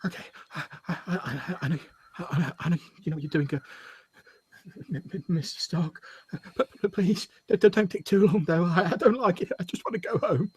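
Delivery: high, soft, stuttering and near tears; small scared voice wanting home; trapped Samantha pleads softly with Mister Stark; frightened whimper. Acted.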